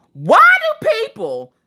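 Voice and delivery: slow, very high theatrical wail; hammed-up exasperation; outraged. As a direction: cry it out in drawn-out mock outrage. Acting out her outraged message.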